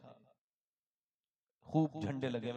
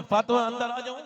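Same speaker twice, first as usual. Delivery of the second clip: raised, higher voice calling to someone inside; louder, lifted call; 8 semitones higher, 15 dB louder than usual. A narrator's reading. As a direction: call to a daughter through the door. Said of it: "आवाज दी हुजूर ने".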